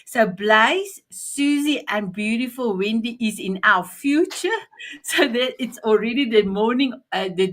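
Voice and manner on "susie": ordinary delivery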